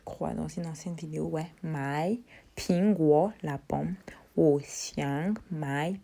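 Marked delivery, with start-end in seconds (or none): none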